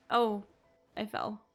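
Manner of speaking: ordinary delivery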